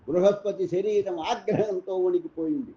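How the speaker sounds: ordinary delivery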